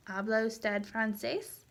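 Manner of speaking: "¿Habla usted francés?" lifting at the end; rising question intonation. Shown as a demonstration. Teacher modelling a yes-no question, voice rising on "francés". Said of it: "your voice rises at the end".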